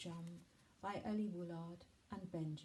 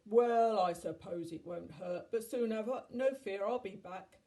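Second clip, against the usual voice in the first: loud giant with a booming "Well"; loud, reluctant storybook giant; obliging giant; 2 semitones higher, 11 dB louder than usual. A narrator's reading. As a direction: agree grandly, in a big giant voice. Giant agrees to help children.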